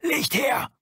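sharp, high call for light. A narrator's reading. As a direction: bark the order into the dark tunnel. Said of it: "legte sich auf den Bauch und rief".

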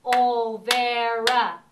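"Olvera" very slow and loud, three separate beats; clapped syllables. Shown as a demonstration. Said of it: "Let's do it together".

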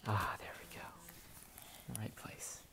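soft unvoiced whisper. Real, not acted. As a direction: breathe it out to yourself. Quiet aside while placing fruit.

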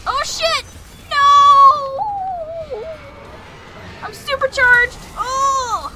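high, loud frantic yells; panicked shouting. Real, not acted. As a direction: scream in panic. Enemies swarm, he screams.

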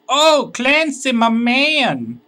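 excited greeting. Acted. Greets the game character warmly.

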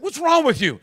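loud, high-pitched scolding; sharp rebuke. Retold or imitated. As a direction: act out an exasperated telling-off. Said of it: "he rebuked the guy".

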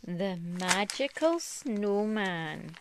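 put-on slow title announcement for a child; story title. A narrator's reading. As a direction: read the title slowly, as a treat.

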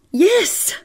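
high, loud, startled yelp; shrill, jumpy phone answer. A narrator's reading.

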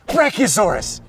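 loud, high eager call; excited guess. Acted. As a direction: shout it out, sure of yourself.

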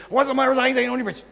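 fast, high, slurred run-together words; rushed drawl imitation. Imitating the stranger's garbled question at the airport, rapid and slurred. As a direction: imitate him gabbling it all as one fast slur. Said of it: "this is exactly the way he said it".

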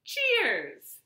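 high, loud party toast; cheerful toast. Acted. Friends toasting at the feast, high cheerful. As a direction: raise a glass and cheer. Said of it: "they all said".

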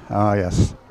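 normal talking voice

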